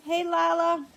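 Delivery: sing-song greeting. Real, not acted. Greeting granddaughter on camera.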